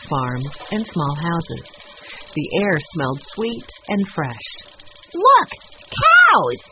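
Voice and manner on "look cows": put-on very high, loud child's cry; excited shout. A narrator's reading. Boy spotting cows from his bike, shouting. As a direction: call out in a piping boy's voice. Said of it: "Benny shouted".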